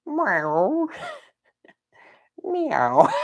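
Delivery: high, drawn-out kitten meow; imitated meow. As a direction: mew playfully like a little cat. Imitates the cat figure.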